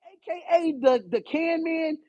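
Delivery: normal talking voice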